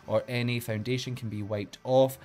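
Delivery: usual speaking voice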